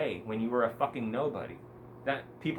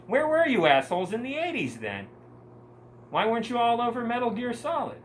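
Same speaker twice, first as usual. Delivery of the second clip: indignant rant; 9 semitones higher, 4 dB louder than usual. Real, not acted. Ranting at gamers.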